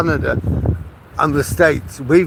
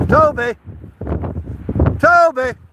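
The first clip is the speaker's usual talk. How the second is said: calling out; 9 semitones higher than usual. Real, not acted. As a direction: shout the dog's name. Calling dog on walk.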